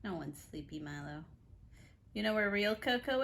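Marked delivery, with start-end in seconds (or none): 0.0-1.2 much softer, lower; quiet. Real, not acted. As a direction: hush it to the sleepy pet. Hushed words to pet.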